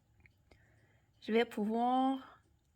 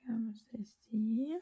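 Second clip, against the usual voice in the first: very slow whispered comme ceci; much slower than usual. Real, not acted. Artist adding shadow, whispering slowly. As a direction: let comme ceci trail out as breath. Drawing, murmuring while shading.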